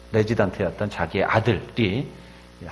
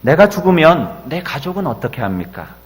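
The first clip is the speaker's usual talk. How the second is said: loud, higher, desperate prayer to God; crying out in prayer; 4 semitones higher, 7 dB louder than usual. Retold or imitated. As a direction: pour it out as a desperate cry to heaven. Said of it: "부르짖어 기도합니다". (하나님께 절규하듯 높고 크게 부르짖는 기도)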